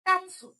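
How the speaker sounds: কাতসু said with the tsu joined to ka; one tight word কাতসু; joined katsu. Shown as a demonstration. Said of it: "joint হয়ে উচ্চারণ হবে".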